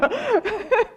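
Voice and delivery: high bright laugh; high burst of laughter. Real, not acted. Speaker laughing at her candlelight plan, high laugh. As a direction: let out a high delighted laugh.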